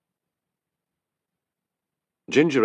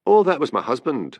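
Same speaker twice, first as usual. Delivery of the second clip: light, higher-pitched cheerful reply; 6 semitones higher, 4 dB louder than usual. A narrator's reading. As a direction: answer breezily, pitch lifted. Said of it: "said Annie cheerfully".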